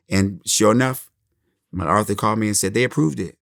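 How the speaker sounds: ordinary talk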